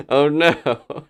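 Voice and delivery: normal talking voice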